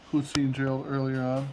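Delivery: usual speaking voice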